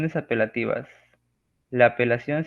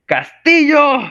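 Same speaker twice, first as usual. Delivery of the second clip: a very high, loud shout of "¡Castillo!"; intense shout; 12 dB louder, slower than usual. Shown as a demonstration. Showing stacked exclamation marks, calling out Castillo at full force. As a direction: yell the name with all the emotion you've got. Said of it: "intensificar la emotividad".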